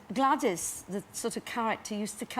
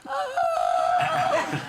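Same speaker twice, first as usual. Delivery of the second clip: high strangled shout of a smoke-choked throat; hoarse yell; 5 dB louder than usual. Retold or imitated. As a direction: cry out high and loud as if the smoke caught your throat. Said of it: "I'd be going".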